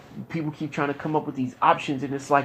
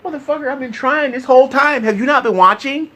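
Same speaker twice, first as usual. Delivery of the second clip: much higher and louder, yelling back at the doubters; furious retort; 11 semitones higher, 6 dB louder than usual. Retold or imitated. Answering those who call him a quitter, furious shout. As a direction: fire it back at whoever called you a quitter.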